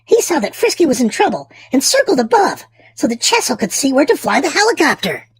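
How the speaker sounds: ordinary delivery, nothing marked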